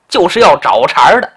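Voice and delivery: usual speaking voice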